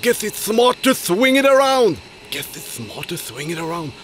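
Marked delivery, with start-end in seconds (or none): 2.3-3.9 low fast mumble. Shown as a demonstration. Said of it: "What a sentence".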